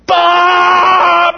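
loud high blaring trumpet imitation; trumpet blast. Teacher imitates the trumpet blast startling sleepers, loud blare. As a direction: blast it out like a sudden horn.

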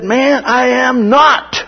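high, drawn-out protest; hammed-up denial, each word stretched high; emphatic denial. Peter denies being one of them, high emphatic protest.

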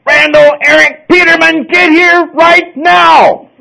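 loud, high, drawn-out shout; parent yelling a child's name. Retold or imitated. Parent summoning a child, furious loud shout. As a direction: bellow it like a mad parent calling a kid home. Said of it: "kids learn to pick up on this".

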